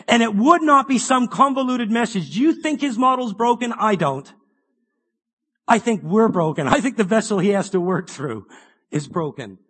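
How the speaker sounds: loud, high, driving preacher voice; full-force preaching. Acted. Preacher urging the congregation to keep Tabernacles, loud and fervent. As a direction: preach it out to the hall with rising fire.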